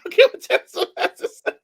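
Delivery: high, breaking up with laughter; words squeezed out between laughs; laughing. Real, not acted. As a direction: repeat it through helpless laughter. Cracking up repeating co-host's joke.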